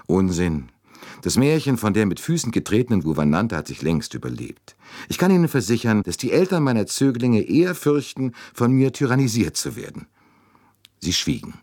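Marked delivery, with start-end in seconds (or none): 0.0-10.0 high, animated dismissal; higher-pitched, mildly heated rebuttal; slightly unrestrained. A narrator's reading. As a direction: protest with a touch of lost control. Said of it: "Es klang eine Spur unbeherrscht".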